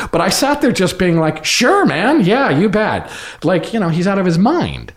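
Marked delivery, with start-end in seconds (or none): 1.4-3.1 much higher, louder breezy mock agreement; humouring sarcasm. Actor humouring director he thinks is crazy, mock agreement. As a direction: brush him off with fake enthusiasm.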